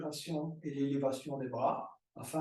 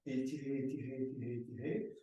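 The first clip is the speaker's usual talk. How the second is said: fading repeated cue; 7 dB quieter, faster than usual. Shown as a demonstration. Yoga teacher urging a stretch.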